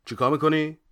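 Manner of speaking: loud, high, angry demand; angry question. Acted. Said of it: "برگشت عقب و عصبانی پرسید". (با عصبانیت و صدای بلند)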